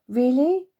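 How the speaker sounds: loud delighted really; can't believe the offer; surprised. Acted.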